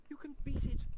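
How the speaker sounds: high, loud urgent encouragement; young lady urging a struggling vampire to hold on. A narrator's reading. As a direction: urge him on, loud and high. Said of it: "she said urgently".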